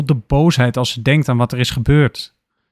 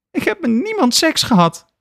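calling out. Acted. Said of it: "roept Lena uit".

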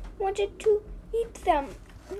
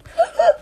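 high, loud pretend sob gasp; mock sobbing gasp; 10 semitones higher, 8 dB louder than usual. Reader mock-crying.

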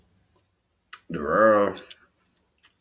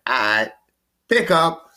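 builds from a touch higher to high and louder; light teasing call, climbing with glee; mock eager greeting; 4 dB louder than usual. Retelling how he finally got through on the phone, rising excited hello. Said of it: "But I'm like".

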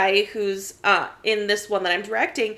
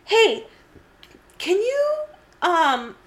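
higher, fast niece voice; niece asking; 3 semitones higher than usual. Retold or imitated. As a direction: rattle off a request like the niece. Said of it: "she goes".

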